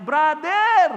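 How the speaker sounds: a loud, high call across the road; shout. Retold or imitated. Man leaning from the bus window, shouting at the gunman. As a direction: yell his name to stop him. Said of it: "sumigaw sa kanya".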